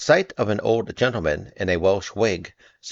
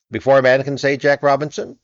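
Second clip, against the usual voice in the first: loud, high jovial command; rally the lads for the party; hearty cry; 6 semitones higher, 6 dB louder than usual. A narrator's reading. Fezziwig ordering shutters up, loud hearty call. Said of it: "cried old Fezziwig".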